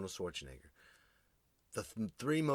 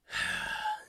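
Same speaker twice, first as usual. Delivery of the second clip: big weary sigh. Retold or imitated. Dad sighing at holidays.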